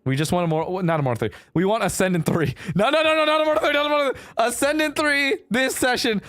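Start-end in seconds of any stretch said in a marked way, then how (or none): 2.8-6.2 high-pitched panicked protest, words repeated in a rush; mock panic, high and urgent; frantic backtracking. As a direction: scramble to take it back, pitch way up. Frantically retracting the rank goal.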